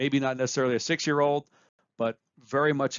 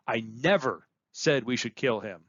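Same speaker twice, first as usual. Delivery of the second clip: never punched up and held; never carries the whole line; stress on never; 2 semitones lower than usual. Shown as a demonstration. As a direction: hit the word never. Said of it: "by where you place emphasis".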